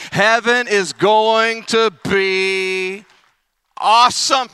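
slow build to a loud, high awesome; drawn-out build to an excited peak; building excitement. Real, not acted. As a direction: stretch it then burst out. Preacher building to a cry.

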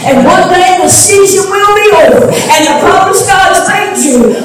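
high, loud preaching climax, singing the phrases; full-force preaching. Acted. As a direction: preach it out to the congregation, rising.

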